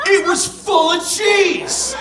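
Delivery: high, slow, triumphant reveal; awed excitement. Acted. Author reads Tommy finding cheese in the fridge, awed excitement. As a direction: stretch it out like a glorious discovery.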